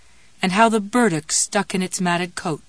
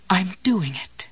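with wonder; slower than usual. A narrator's reading. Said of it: "Jessie thought with wonder".

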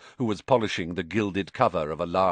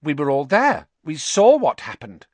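higher and louder, summoning nerve; bracing himself; 6 semitones higher, 8 dB louder than usual. A narrator's reading. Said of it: "taking a deep breath".

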